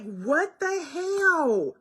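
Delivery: slow, high, drawn-out What the hell?; incredulous. Retold or imitated. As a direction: stretch out the disbelief. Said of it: "And I'm like".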